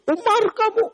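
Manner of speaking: high, loud, disrespectful call; rude blunt address. Retold or imitated. The Badui rudely addresses Umar to his face, loud and blunt. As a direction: bark the caliph's name with no respect. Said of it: "ngomongnya begitu".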